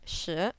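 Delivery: shi lifted like asking "what?"; questioning rise on shi. Shown as a demonstration. Teacher swaps the question word for ten, rising shi. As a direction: slide shi upward as a question. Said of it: "imagine you're asking the question".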